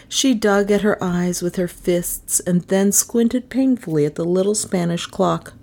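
ordinary delivery

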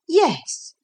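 put-on high, firm little girl. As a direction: say it firmly, no arguing. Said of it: "said Lucy with firmness".

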